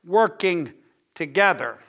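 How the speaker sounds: "working together" set apart, low and soft; emphasis on working together. Real, not acted. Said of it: "let me emphasize the word".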